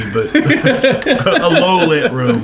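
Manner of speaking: slow, giggling correction; conceding the point through laughter; laughing concession. Real, not acted. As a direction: laugh it out slowly. Laughing counterpoint.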